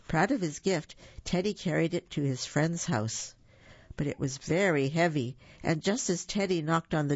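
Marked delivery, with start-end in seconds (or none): none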